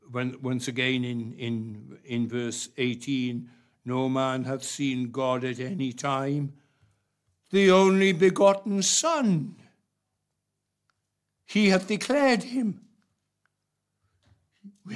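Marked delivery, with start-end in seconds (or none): 3.8-12.8 proclaimed scripture. Acted. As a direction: declare it from the pulpit like a revelation. Preacher proclaiming a Bible verse.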